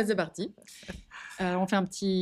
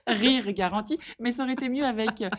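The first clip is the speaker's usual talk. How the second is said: laughing; 5 semitones higher, 5 dB louder than usual. Real, not acted. Laughing at own joke.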